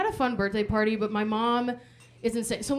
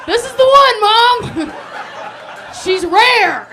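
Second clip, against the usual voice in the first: excited dog; 10 semitones higher, 11 dB louder, slower than usual. Retold or imitated.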